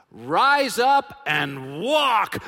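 slow, loud, drawn-out command; commanding shout. Pretend. Friends imagine Jesus shouting the healing command, slow and loud.

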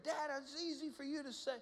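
put-on high, complaining little boy; a child pushing back; whiny kid voice. Son protests, voiced by father.

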